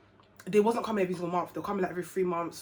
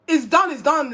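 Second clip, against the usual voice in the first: loud, higher, rising in alarm; panicked outburst; 7 semitones higher, 11 dB louder, slower than usual. Retold or imitated. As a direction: burst out, overwhelmed. Panicked inner thought after news.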